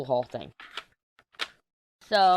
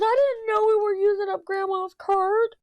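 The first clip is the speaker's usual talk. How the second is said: very high, loud mock whine; squeaky, hammed-up kid protest; whiny kid imitation; 6 dB louder than usual. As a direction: wail it like an upset child. Voices son's whining complaint.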